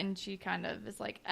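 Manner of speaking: ordinary delivery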